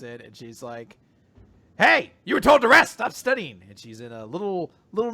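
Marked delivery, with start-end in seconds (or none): 1.8-3.6 loud, high-pitched telling-off; mock scolding. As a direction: scold like a bossy nurse, hammed up. Girl scolds him for studying.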